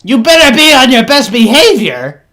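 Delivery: high, loud scolding yell; yelling back. Acted. Beholder answering the rock thrower, angry yell. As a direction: yell a stern warning across the field. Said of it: "yells back".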